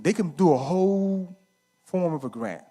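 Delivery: usual speaking voice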